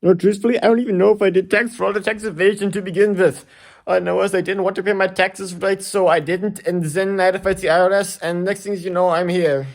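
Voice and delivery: high, loud nervous babbling; frantic rant. Acted. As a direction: blurt it all out in a panic. Frantic confession to the emperor.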